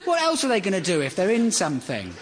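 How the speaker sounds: normal talking voice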